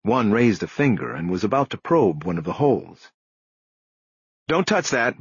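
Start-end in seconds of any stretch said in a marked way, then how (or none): 4.5-5.2 higher-pitched sharp command; Bosch, barked order. A narrator's reading. Detective stopping an officer about to probe bullet holes, barking. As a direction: bark it as an order, without shouting. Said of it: "Bosch barked".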